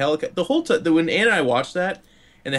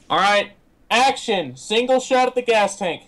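higher, drawn-out call of a director on set; film director calling action; 6 semitones higher, slower than usual. Acted. Film crew cueing the actor on set, calling out.

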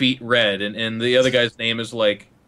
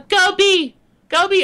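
put-on very high, loud kid voice; nine-year-old gamer; 6 dB louder than usual. Child bossing teammates from bottom of scoreboard, squeaky commands. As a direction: imitate a little kid shot-calling. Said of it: "when they are actually nine years old".

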